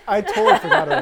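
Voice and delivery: amused at own forgetfulness, breaking up; laughing. Real, not acted. Friend admits forgetting Avatar two, laughing out loud.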